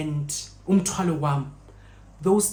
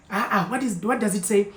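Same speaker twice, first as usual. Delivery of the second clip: ordinary delivery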